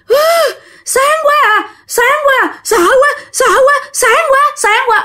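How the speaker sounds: very high, wailing panic; frightened crying out. Retold or imitated. Possessed uncle clutches his head, wailing in fear. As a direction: cry out as if tormented by blinding light. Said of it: "cái lúc này chú Út mới la làng lên".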